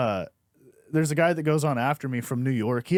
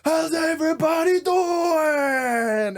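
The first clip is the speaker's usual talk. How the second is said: much higher, slow, shouted crowd greeting; hammed-up stage greeting; 11 semitones higher, much slower than usual. Bombing comic greeting a silent crowd, hammed-up shout. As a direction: bellow it like a desperate showman. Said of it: "He was like".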